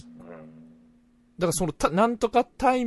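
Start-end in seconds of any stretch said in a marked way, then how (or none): 1.9-2.8 put-on high, louder old Hakata "タイ" ending; demonstrated traditional Hakata phrase. Shown as a demonstration. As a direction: quote the Hakata "タイ" with emphasis. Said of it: "博多弁的な フレーズがもうあんまり出ないね".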